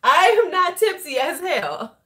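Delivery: high-pitched, playful denial; mock protest. Tipsy vlogger jokingly denying it to viewers, high mock protest. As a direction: protest it high and indignant, in jest.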